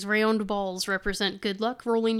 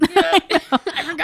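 slow panicky wail; drawn-out mock panic; 7 semitones higher, 9 dB louder, much slower than usual.

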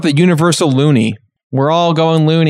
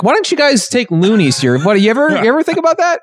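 higher, lightly mocking guy; a goofy stranger asking, pitched up; mock random guy; 8 semitones higher than usual. Random guy at gas station.

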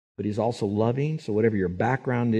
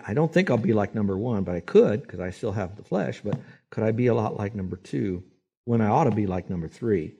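ordinary talk, nothing marked

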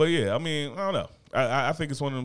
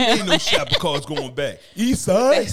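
mock rebel shout; 7 semitones higher, 6 dB louder, slower than usual.

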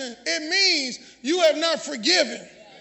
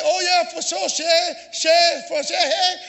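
imitated New Orleans speech; New Orleans accent; 3 dB louder, slower than usual. Preacher showing how locals talk, imitated New Orleans accent. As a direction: put on New Orleans vowels in "sure". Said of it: "they come from New Orleans".

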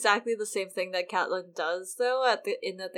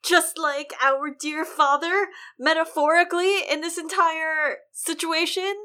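milk it like a conspiracy reveal; mock dramatic; 7 semitones higher, 7 dB louder than usual. Podcaster dramatizes a stabbing to her co-host, mock dramatic.

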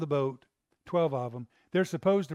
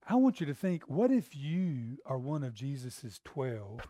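usual speaking voice

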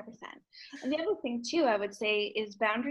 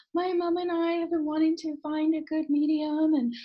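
higher, slightly slowed, a hint of held-back tears; tearful; 6 semitones higher than usual. Cashier telling the medium about her dad, lightly tearful. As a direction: echo the cashier's emotional voice. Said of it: "and she started crying".

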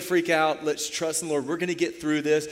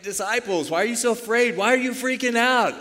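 high, loud incredulous questioning; mock exasperation; 8 semitones higher, 5 dB louder than usual. Jesus asking the panicking disciples why, mock exasperated. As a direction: ask in hammed-up, animated disbelief.

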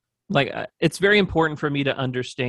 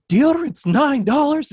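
high, loud, drawn-out shopper's outburst; imitated scream; 14 semitones higher, 7 dB louder than usual. Imitating a shopper outraged over prices in Walmart, screamed. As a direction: screech it slowly, imitating an outraged customer. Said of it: "she, like, screamed it".

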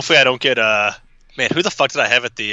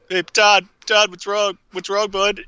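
mock concerned voice; 8 semitones higher, slower than usual. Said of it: "I'm like".

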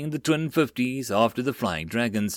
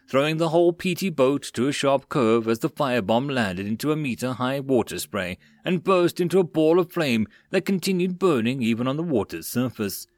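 ordinary delivery

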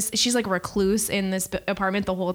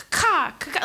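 higher mock crow caw; 8 semitones higher than usual. Retelling a sitcom bit, mock crow caw. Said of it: "non-chicken-like sounds".

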